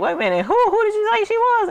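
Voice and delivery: climbing to a very high, astonished squeak; auntie's surprise. Retold or imitated. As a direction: let the question shoot way up in disbelief. Auntie realizes it's her niece.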